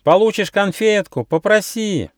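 очень высокий детский голосок; зазывай тонким голосом, как ребёнок; кричащие дети. A narrator's reading. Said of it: "кричали дети". (детский выкрик на высокой ноте)

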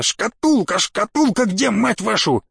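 frantic, high-pitched repeated demand; agitated cursing cry; furious yelling. Acted. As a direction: demand it in desperate exasperation. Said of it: "заорал".

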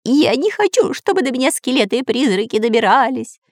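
high-pitched panicky protest; scared, high whine; frightened bawling. A narrator's reading. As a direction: bawl it in a high cartoon voice. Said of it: "орал Дырка".

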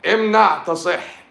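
high, slow and loud, mock proclamation; shouted slogan. Doctor yelling a mock slogan at the audience, slow and loud. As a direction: bellow it out like a hammy slogan.

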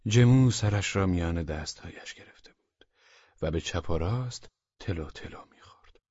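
ordinary delivery, nothing marked

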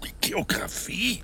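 a whispered, high, slow disbelieving echo of the word; incredulous echo. Acted. Watson echoing Holmes's strange word, whispered and incredulous. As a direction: repeat it back as if you can't believe it.

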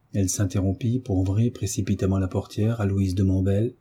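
plain reading voice

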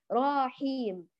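راحيم with a long aa after the r; inserted alif. Shown as a demonstration. Teacher shows what the reciter wrongly added, stretched ra. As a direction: stretch the first vowel of راحيم. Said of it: "أدخلت ألفًا بين الراء والحاء".

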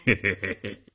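soft acted chuckle; short run of soft heh-hehs; low chuckle. A narrator's reading. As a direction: chuckle quietly to yourself. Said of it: "呵呵地笑了".

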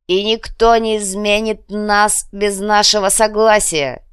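commanding proclamation. A narrator's reading. Prince proclaims to the crowd.

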